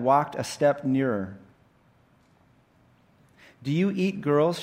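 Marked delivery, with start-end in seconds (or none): 3.6-4.6 put-on high, wary girl's question; worried Jill. Jill asks the lion if it eats girls, nervous. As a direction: ask it nervously, pitched up.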